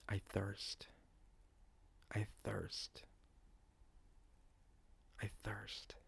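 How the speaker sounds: whispered, fading further with each repeat; faint dying whisper. Acted. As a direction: breathe the words out like a parched, dying man. Dying Jesus on the cross.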